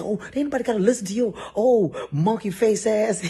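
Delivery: high, loud and fast, laughing insult; mocking insult. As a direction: fire back fast. Boss insulting back.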